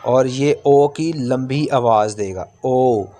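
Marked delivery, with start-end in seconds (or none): none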